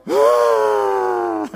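loud hammed-up bawl; acted father's sob. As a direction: burst into a mock wail. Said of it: "Begitu dia nangis, bapak ayo".

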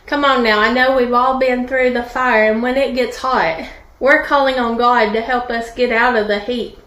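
normal talking voice

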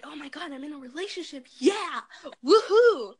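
squealing with rising glee; excited. Retold or imitated. Girl texting her new relationship, excited cheer. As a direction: celebrate like a giddy teen.

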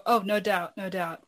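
ordinary talk, nothing marked

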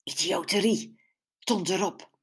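hissed, contemptuous heckle; angry hiss. A narrator's reading. Man at the fish stall grumbles at the party plan, hissing. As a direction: spit it out under your breath. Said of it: "siste weer iets".

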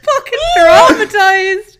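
laughing so hard it nearly cries; slow, high, loud through laughter. Real, not acted. Laughing hard at own story.

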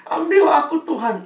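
high, pleading mother begging God, voice breaking; weeping mother's plea. Retold or imitated. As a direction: beg God to take her instead. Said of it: "mamanya ... nangis-nangis". (ibu menangis memohon kepada Tuhan, suara tinggi)

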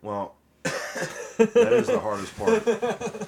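slow, high, loud drawl; put-on drawl. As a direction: stretch it out in a silly voice. Drawn out put-on voice.

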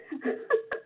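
quick airy laugh under the breath; soft breathy laugh. Real, not acted. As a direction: let a little laugh slip out. Laughs at the punchline.

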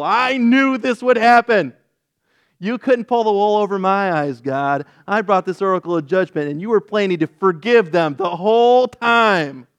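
high, loud, sulky accusation; petulant. Retold or imitated. Jonah sulkily accusing God of planning to forgive, loud and high. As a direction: whine like a cheated child.

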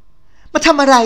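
high, loud shriek of a jealous rival; a rival yelling to drive her off; furious shout. Acted. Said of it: "น้ำเสียงเกรี้ยวกราดตวาดลั่น".

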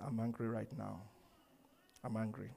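low, soft, playful sulk; mock anger. Husband playing with his wife, mock-angry and quiet. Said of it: "That's when I'm playing with my wife".

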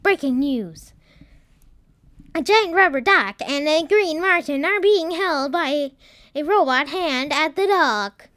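projected news-bulletin voice; news anchor. Acted. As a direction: announce it like a TV newsreader. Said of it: "there was a human in a chair, saying".

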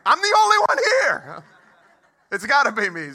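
high, loud, laughing yell; laughing mock panic. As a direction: shout it in mock panic, cracking up. Realizing he's being pulled over.

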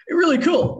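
light up and push the word really; real excitement. Real, not acted. Excited about the friendship.

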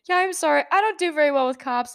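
high, fast, anxious apology; pleading with the cop, scared and rambling. Retold or imitated. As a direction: blurt it out, frightened and sorry. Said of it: "I just start rambling again".

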